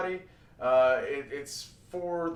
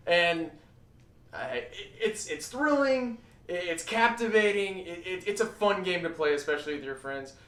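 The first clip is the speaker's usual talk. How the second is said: ordinary delivery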